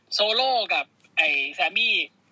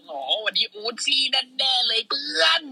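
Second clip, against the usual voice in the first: hammed-up commentator; 8 semitones higher than usual. Commentator voice impression.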